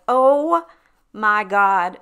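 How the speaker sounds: loud, high burst of recognition; sudden joyful disbelief; shocked shout. Retold or imitated. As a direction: yell it as it dawns on you. Recognizing an old school friend.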